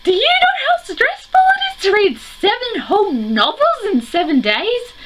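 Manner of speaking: very high-pitched, hammed-up squeal of stress; exasperated mock outburst. Vlogger answering those who call it cheating, mock-frantic high squeal. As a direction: squeal it in exaggerated disbelief. Acted stressed retort to critics.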